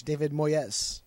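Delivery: Moyes said slowly as Spanish "Mo-yes"; slow, Spanish-sounding David Moyes; Spanish pronunciation. Shown as a demonstration. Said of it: "It's Spanish".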